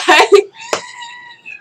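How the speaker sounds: very high shriek of laughter. Real, not acted. Vlogger shrieking with laughter while signing off. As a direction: let out a piercing laughing shriek.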